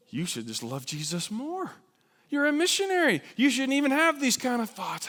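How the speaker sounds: high, loud scolding voice; judgmental church voice. Acted. Scolding churchgoer lecturing a struggling missionary, loud and high.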